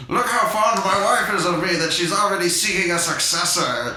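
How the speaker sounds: mock outraged old man; mock rant. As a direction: sneer it like a jealous old husband. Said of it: "He goes on this rant".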